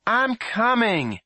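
slow loud high call; drawn-out giving up; called reply. A narrator's reading. Boy finally answering his mother, slow loud call.

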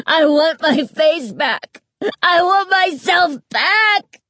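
high, slow, loud screaming. A narrator's reading. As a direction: scream at the top of your lungs. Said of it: "She was screaming at the top of her lungs".

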